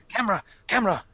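robotic warning, lightly voiced; robot alarm voice. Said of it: "I'll do it in a more style, befitting me".

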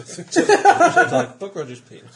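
laughing. Real, not acted. Joke through laughter.

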